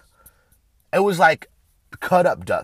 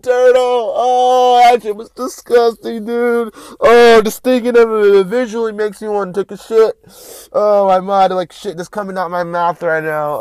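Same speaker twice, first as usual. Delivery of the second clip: loud, high wail of disgust, slowly easing down; grossed-out outcry; 8 semitones higher, 11 dB louder than usual. Real, not acted. Host reliving a disgusting turtle video, loudly revolted. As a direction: groan it out as if about to be sick.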